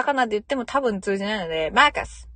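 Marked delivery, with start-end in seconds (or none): 1.7-2.3 マーカス with a long, lifted first vowel, like "circus"; circus-like MARCUS. Shown as a demonstration. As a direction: punch out マーカス loud and high. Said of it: "サーカスみたいなテンションで".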